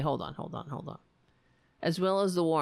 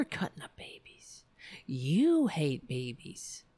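accusing whisper; 5 semitones lower than usual. Real, not acted. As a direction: drop to a whisper to accuse them. Accusing the Republicans.

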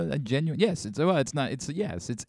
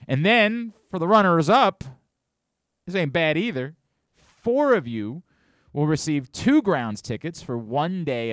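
higher, louder pitchman with pauses; hyped promo read; 5 semitones higher, 6 dB louder than usual. Acted. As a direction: announce the prizes with punch. Host sells runner-up prizes.

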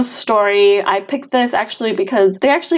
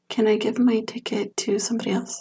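sheepish whispered question; 7 dB quieter than usual. Real, not acted. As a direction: whisper it like asking a sneaky favour.

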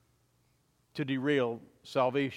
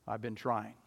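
soft, sorrowful admission; mild sad resignation; rather sad; 4 semitones lower, 7 dB quieter than usual. A narrator's reading. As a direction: say it sadly, almost giving up. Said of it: "said to her rather sadly".

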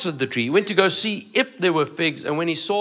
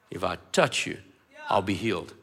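quiet, low; 12 semitones lower, 10 dB quieter, faster than usual. Acted. Woman's quoted words.

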